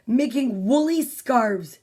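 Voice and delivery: ordinary delivery, nothing marked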